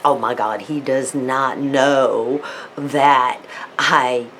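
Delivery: ordinary talk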